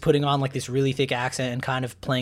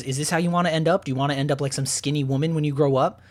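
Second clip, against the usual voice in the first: ordinary talk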